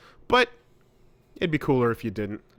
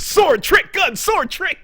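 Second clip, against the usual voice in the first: excited meme shout; 6 dB louder, slower than usual. Retold or imitated. Quoting a game meme catchphrase.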